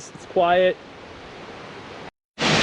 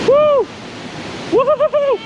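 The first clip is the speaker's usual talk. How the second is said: excited whoop. Real, not acted. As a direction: whoop at the icy water. Stepping into cold water.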